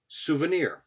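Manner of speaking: ordinary delivery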